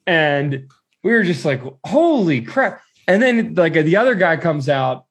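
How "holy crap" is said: high, drawn-out disbelief; a touch of mock shock; stunned reaction. Friends see the guard's hand on gun, retold shock.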